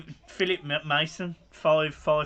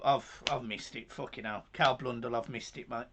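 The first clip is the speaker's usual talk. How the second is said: much softer, emotion welling up; choked up; 5 semitones lower, 9 dB quieter than usual. Real, not acted. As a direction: voice dropping away, overcome by feeling. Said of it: "he does get emotional very easy".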